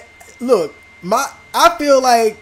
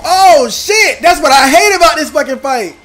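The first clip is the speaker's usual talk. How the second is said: raging, louder and higher yell at the game; angry shout; 6 semitones higher, 7 dB louder than usual. Real, not acted. Gamer yelling at his losing fight, furious shout. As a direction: blow up at the fight you just lost.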